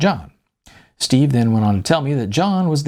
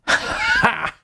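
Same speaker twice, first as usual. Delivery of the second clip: sharp barking laugh of delight; loud "Ha!". Acted. John slaps his knee at the flask, sharp laugh. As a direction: burst out with a single laugh. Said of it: "exclaimed John as he slapped his knee".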